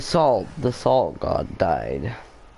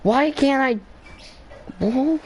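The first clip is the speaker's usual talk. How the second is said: much higher, frustrated cry then startled whoa; exasperated surprise. Real, not acted. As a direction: yelp at the game as the jump fails. Jump fails again in game.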